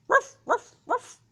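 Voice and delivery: high, loud yappy barking; dog bark. Shown as a demonstration. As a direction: bark like a playful pup. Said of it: "Can you make the sound of a dog".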